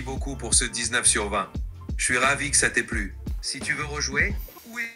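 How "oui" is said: ordinary talk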